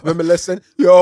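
high, loud whiny yes after the question; mock whiny reply. Friend replaying the boy's teary reply, high and loud. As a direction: ham up the teary child.

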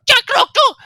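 very high, loud squeal in Draconic; little creature yelping in fright; frightened cry. Acted. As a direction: shriek it out, small and scared. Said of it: "frightened as it goes".

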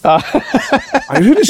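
laughing through the words, pitch jumping; can't get it out for laughing. Real, not acted. Friend laughing at a mate's joke about England.

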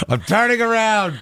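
high sulking voice; squeaky sulk. Pretend. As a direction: huff in a squeaky voice. Mock sulky partner continues.

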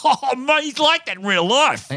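high, mock-amazed exclamation; imitated excited fan. Comic imitates a fan convinced he's the killer, excited. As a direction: mimic a thrilled punter telling his mate.

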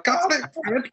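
very high, gleeful squeal of the words; over-the-top joy at a treat; delighted exclamation. Retold or imitated. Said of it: "It's like".

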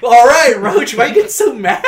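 loud, high, drawn-out mock protest; hammed-up high complaint at an angry sergeant. Acting out his reply to sergeant.

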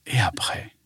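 whispering. Acted. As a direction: whisper it as a teaser. Whispered question at story end.

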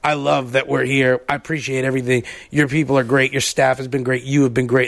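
a hint of Mike thanking the owner; drunk Mike. Co-host imitates drunk Mike thanking an owner, low and gushing. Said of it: "my [name] impression of Mike".

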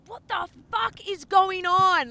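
slow, high, very loud furious yell; full-force mock scream of outrage; retold screaming. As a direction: imitate an enraged man screaming, drawing out each word. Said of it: "Like, screaming at me".

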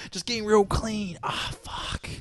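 high, slow, hammed-up moan trailing to a breathy gasp; mock moaning guy. Mocking a friend who moans in the shower, hammed-up moan. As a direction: act out a guy moaning while cleaning himself. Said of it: "Does he moan?".

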